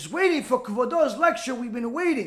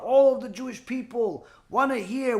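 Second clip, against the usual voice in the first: ordinary talk, nothing marked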